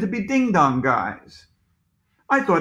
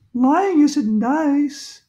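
high, louder, drawn-out schoolgirl reproach; scolding girl; 10 semitones higher, 5 dB louder, much slower than usual. A narrator's reading. Andrea tells off the principal, high and reproachful. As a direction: scold slowly in a high girl's voice.

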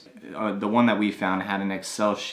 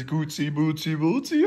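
put-on squeaky high baby talk; puppy whimper; 6 semitones higher than usual. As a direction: coo up into a squeal.

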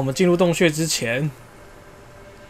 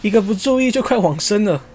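louder, higher hyped play-by-play; excited fight commentary; 6 semitones higher, 3 dB louder than usual. Real, not acted. Player fighting a fast-healing monster, loud excited commentary. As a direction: rattle it off keyed up mid-battle. Tough monster nearly kills him.

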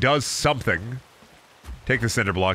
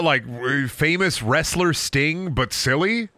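ordinary talk

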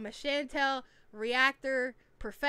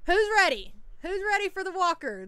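high, loud hype to the chat; rally the viewers, pitched up; excited streamer hyping the crowd; hyped call-out; 7 semitones higher, 5 dB louder than usual. Real, not acted.

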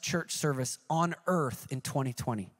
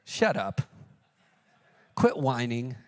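a lower, deadpan God cutting off complaints; blunt voice of God; 6 semitones lower than usual. Retold or imitated. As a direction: brush off the whiner, unimpressed. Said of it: "And God goes".

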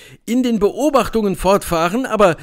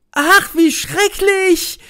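much higher, slow shrieking lament; shrieking girls; 12 semitones higher, 3 dB louder, much slower than usual. A narrator's reading. Frightened summer girls shrieking at the eclipse. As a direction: wail it like a frightened girl. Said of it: "kreischten die bunten Mädchen".

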